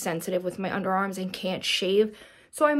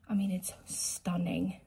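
slow, awed whisper; 8 dB quieter than usual. Real, not acted. Showing viewers the gift bag, an awed whisper. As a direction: breathe it out in awe.

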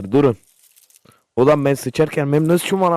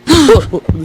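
startled scream; 8 semitones higher, 9 dB louder than usual. Real, not acted. Jumpscare by dinosaur.

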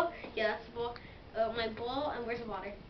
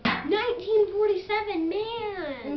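surprised; 5 semitones higher, 5 dB louder, slower than usual. Real, not acted. Hears expiry date 1947.

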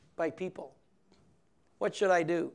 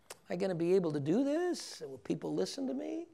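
quietly doubting; 8 dB quieter than usual. Retold or imitated. Priest voicing his own doubts.

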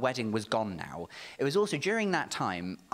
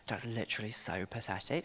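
lower, softer sneer with a laugh; 5 semitones lower, 8 dB quieter than usual. Retold or imitated. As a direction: drop the voice into quiet contempt. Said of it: "he was like".

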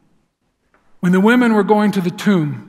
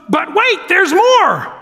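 higher, punchy infomercial pitch; Billy Mays pitch; 10 semitones higher, 3 dB louder, slower than usual. Retold or imitated. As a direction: sell it like a late-night TV pitchman. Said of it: "I get to be that Billy Mays".

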